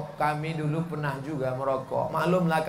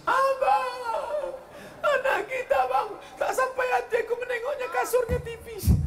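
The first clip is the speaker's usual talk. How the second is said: high, whiny mock-weeping wife; crying wife. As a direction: wail it like a tearful wife begging her husband.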